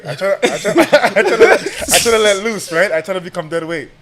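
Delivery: high, laughing through the words; cracking up while telling it. Real, not acted.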